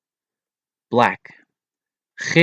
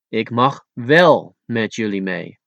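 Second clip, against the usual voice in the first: ordinary delivery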